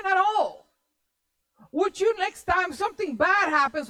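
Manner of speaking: high, loud indignant scolding; acted angry wife. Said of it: "And then she got angry".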